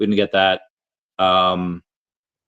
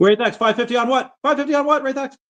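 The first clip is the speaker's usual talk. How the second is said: excited; 13 semitones higher than usual. Real, not acted. Live auction bid calling.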